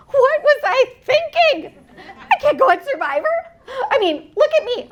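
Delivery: high, wailing self-doubt played for laughs; hammed-up tearful panic, pitched high; mock bawling. Survivor telling the audience how she bawled on her porch, mock wailing. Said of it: "I start bawling".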